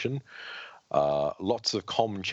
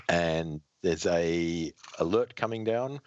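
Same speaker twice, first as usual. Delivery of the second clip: ordinary delivery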